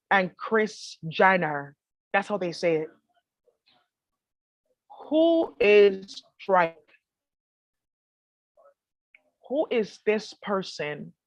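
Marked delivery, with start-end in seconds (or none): 5.1-11.1 very slow, drawn-out, unhurried questions; slow calm name reading. Retold or imitated. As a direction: read each name out slowly, as if weighing it. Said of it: "That's how they talk when they're reading the names".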